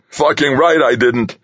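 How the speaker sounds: high-pitched, heated comeback; angry retort. A narrator's reading. Delaney rejects Harry's attempt to placate him, angry retort. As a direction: fire it back at him angrily.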